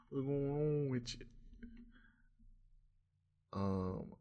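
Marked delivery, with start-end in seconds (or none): 0.1-1.3 [name] impression. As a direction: croon the question in a rapper's voice. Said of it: "start talking like [name]".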